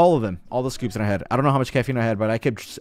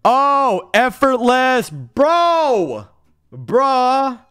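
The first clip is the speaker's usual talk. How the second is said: high, loud crowing over an easy win; loud gloating; 12 semitones higher, 6 dB louder, much slower than usual. Real, not acted. Streamer bragging to viewers after a win, loud gloating. Crows over success in game.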